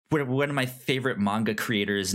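usual speaking voice

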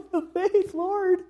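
high, put-on pleading voice; mock despairing widow. Widow in despair supposedly crying to the Lord, mock pleading. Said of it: "she was in despair and then she said".

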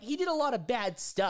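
high mock pitch voice; imitating the lady. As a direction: pitch it like a vague saleswoman. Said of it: "she's like".

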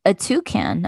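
plain reading voice

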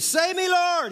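high-pitched shouted plea; desperate shout. A narrator's reading. Peter sinking calls to Jesus, desperate shout. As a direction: shout for help, voice leaping high. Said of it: "he shouted".

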